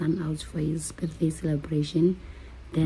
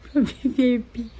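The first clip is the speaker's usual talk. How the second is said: excited; 3 semitones higher, 4 dB louder than usual. Real, not acted. Excited build through ticket upgrades.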